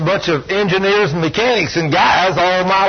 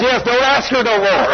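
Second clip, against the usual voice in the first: laughing quote; 6 semitones higher than usual. Retold or imitated. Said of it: "He says".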